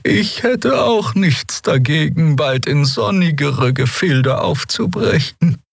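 lazy, drawn-out high character voice; slow, high, languid delivery; sluggish. A narrator's reading. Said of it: "sagte Xea träge".

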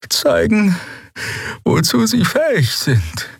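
slow, high, effortful voice; weakened, halting figure. Acted. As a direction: labour through the words, high and slow. Weakened Xea finishes her thought. (hohe, langsame, mühsame Stimme)

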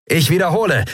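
high, insistent call into a speaking tube. A narrator's reading. Crewman repeats summons into speaking tube, high insistent call. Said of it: "rief Sephiron in eines der Sprechrohre".

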